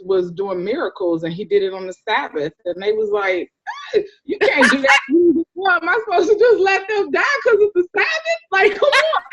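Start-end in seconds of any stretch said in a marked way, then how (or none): 3.9-9.2 high-pitched mock protest, building; exasperated, laughing mock argument; incredulous retort. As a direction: protest higher and higher in disbelief. Acting out critics and retort.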